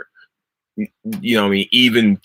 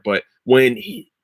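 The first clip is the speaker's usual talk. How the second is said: ordinary talk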